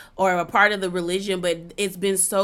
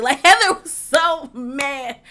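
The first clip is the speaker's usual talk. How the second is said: laughing; 6 semitones higher, 5 dB louder, slower than usual. Real, not acted. Laughing while recapping the fight.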